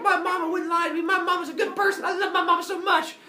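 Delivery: put-on high, loud indignant little-boy voice; hammed-up squealing protest; enraged child. Boy defending his mama to bullies, high enraged child voice. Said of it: "Phaethon is enraged".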